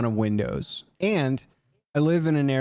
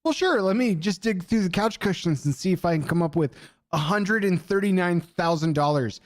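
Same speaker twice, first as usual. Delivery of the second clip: sarcastic; 6 semitones higher than usual. Retold or imitated. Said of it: "is like".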